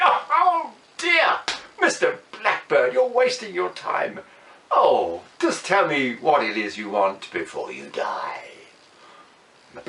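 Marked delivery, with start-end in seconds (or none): 0.0-8.5 high, haughty, mocking king. King on his throne, mocking the blackbird in a haughty voice. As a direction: sneer down at the little bird.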